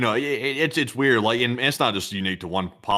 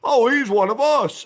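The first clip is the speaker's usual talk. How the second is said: high, loud, slow mock-dim voice; gullible voter impression; 11 semitones higher, 6 dB louder than usual. Voters fooled by a phony politician, mock gullible voice. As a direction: mimic a gullible fan, drawn out. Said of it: "people that aren't very bright".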